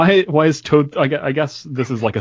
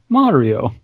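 ordinary talk, nothing marked

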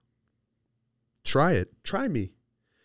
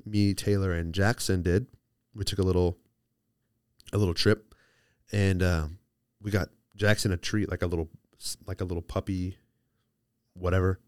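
ordinary delivery

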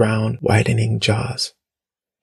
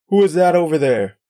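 higher-pitched call, a restrained yell; 6 semitones higher, 3 dB louder than usual. A narrator's reading. Stranger challenging people in a clearing, mild yell. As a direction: call out sharply from a distance. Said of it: "yelled someone".

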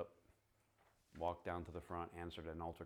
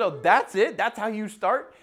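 much higher, louder, amused disbelief; mock disbelief; 21 dB louder than usual. [name] teasing Matt about his opener, in amused disbelief. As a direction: tease him for the lame opener.

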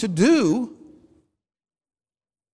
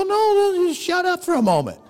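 exasperated interruption; 8 semitones higher than usual. Retold or imitated. God cuts off the argument.

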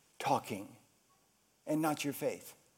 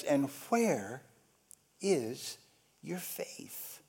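soft question; 7 semitones lower, 11 dB quieter, much slower than usual. Acted. Jesus asks, fading to whisper.

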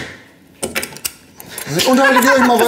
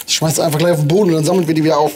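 ordinary delivery

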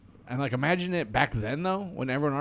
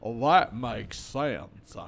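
1 slow; 5 semitones lower, 3 dB quieter, much slower than usual. Acted. Mock slow musing.

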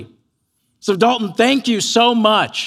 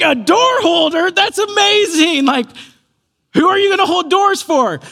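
high-pitched, delighted amazement; exaggerated encouraging enthusiasm, pitched way up; gushing mom; 10 semitones higher, 4 dB louder than usual. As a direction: imitate a mother hyping up her little boy. Mom gushes over child's role.

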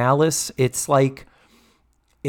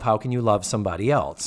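ordinary talk, nothing marked

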